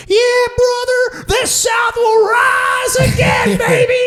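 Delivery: very high, drawn-out Texan holler; drunk Texan. Retold or imitated. Drunk fan celebrating in a Boston bar, Texan yell. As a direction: whoop it slowly in a hammed-up Texan accent. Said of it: "I start talking like a Texan".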